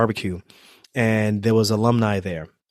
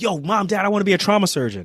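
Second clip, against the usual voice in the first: pitched up, buzzing with news; excited announcement; 8 semitones higher, faster than usual. Retold or imitated. Teen telling parents his dream career, excited and high. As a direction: burst in the door with big news. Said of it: "I'm like".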